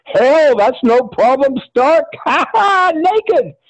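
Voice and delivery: high-pitched, laughing glee; hammed-up giddy bravado; giddy acceptance. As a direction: brush it off in high delight. Chris gleefully accepts nude role.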